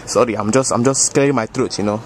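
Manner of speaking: put-on thick West African accent, easygoing; African uncle accent. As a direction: play a boastful African uncle. Same character voice.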